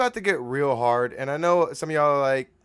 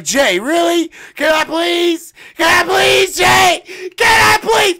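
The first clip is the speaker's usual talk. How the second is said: hammed-up, very high screaming plea; shouted begging; 14 dB louder, slower than usual. Begging Jay to let him step in, mock shrieked plea. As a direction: beg at the top of your lungs. Acts out begging his friend.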